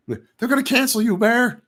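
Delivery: high mock voice teasing Bear that he'll be cancelled; mock warning voice. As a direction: squeak it out like a taunting viewer. Voices a mocking viewer.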